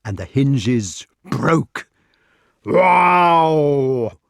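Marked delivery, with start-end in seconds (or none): 2.7-4.2 loud, higher drawn-out wail; long loud yowl; giant's cry. A narrator's reading. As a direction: howl it out in alarm. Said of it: "cried the giant Galapas".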